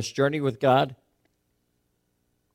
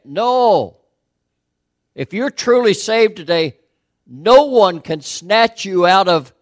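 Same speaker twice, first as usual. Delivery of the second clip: higher, louder rebuttal; forceful preaching; 5 semitones higher, 6 dB louder than usual. Acted. Preacher refuting the liberals' reading, higher and louder. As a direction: thunder it back at the doubters.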